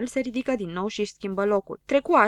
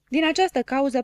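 plain reading voice

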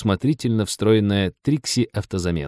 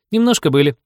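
put-on higher-pitched, mildly contrite girl; rueful; 8 semitones higher, 4 dB louder than usual. A narrator's reading. Лиза confirms to Женька she was punished, ruefully. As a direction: admit it with a touch of regret. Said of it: "сокрушённо подтвердила Лиза".

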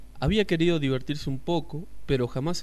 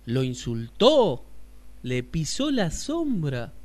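asombrado; 2 semitones higher, 5 dB louder, slower than usual. Acted. Asombro ante la queja.

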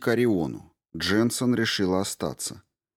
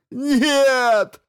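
high, loud howled no; 7 dB louder than usual. A narrator's reading. The astrophysicist howls at Rao's plan, high loud howl. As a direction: wail it out in protest. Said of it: "взвыл астрофизик".

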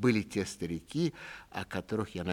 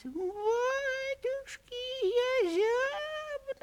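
very high, very slow creaking wail; moaning door voice; much slower than usual. A narrator's reading. Entryway door moaning to the household, very high slow wail. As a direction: stretch it out like a rusty hinge crying. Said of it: "дребезжащий и вместе стонущий звук".

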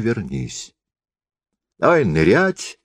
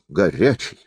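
ordinary delivery

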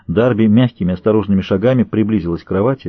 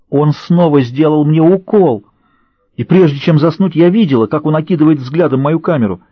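frightened; 5 semitones higher than usual. A narrator's reading. Captive recalling being drugged, frightened.

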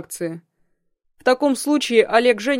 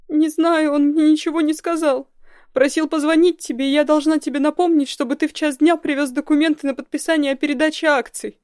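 high, loud sobbing voice; 8 semitones higher, 6 dB louder than usual. A narrator's reading. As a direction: sob out the words. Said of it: "зарыдала она".

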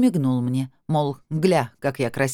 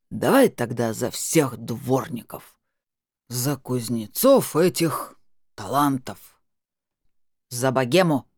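slow, lower, lecturing toast; preachy correction; 4 semitones lower, slower than usual. Acted. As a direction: set him right like a teacher. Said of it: "назидательно поправил Семён".

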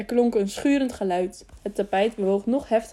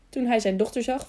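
usual speaking voice

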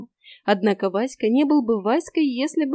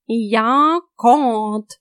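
loud, proud cat voice; proud declaration; 6 dB louder than usual. A narrator's reading. Cat proudly corrects the girl, loud. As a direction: puff up and declare it. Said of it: "гордо заявил Васька".

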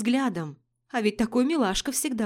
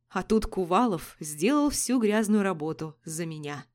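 plain reading voice

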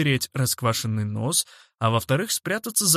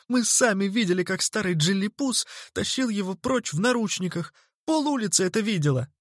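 high-pitched, animated telling; impatient outburst; 7 semitones higher than usual. A narrator's reading. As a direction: blurt out the eyewitness news. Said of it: "не выдержала Зерлина". (взволнованно выпалить)

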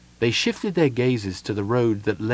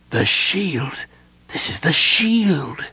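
aghast, higher, drawn-out realisation; 6 semitones higher, much slower than usual. A narrator's reading. Sylvan recognises the magical shield, aghast. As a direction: let the horror of recognition stretch the words. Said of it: "he repeated aghast".

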